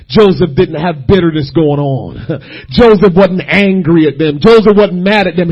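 normal talking voice

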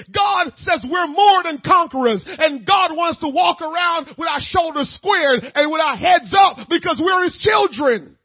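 loud, high, shouting sermon; preaching at full force. Acted.

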